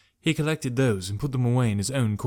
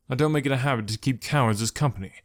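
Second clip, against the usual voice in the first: ordinary delivery, nothing marked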